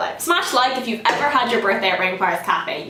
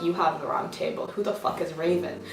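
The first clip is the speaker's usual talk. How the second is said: low aside; 4 semitones lower, 8 dB quieter than usual. Retold or imitated. Confused reply to waitress, retold.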